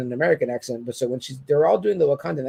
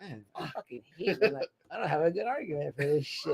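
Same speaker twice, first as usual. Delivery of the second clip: grudging mutter; 10 dB quieter than usual. Retold or imitated. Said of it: "you get called out and you're like".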